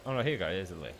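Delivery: ordinary talk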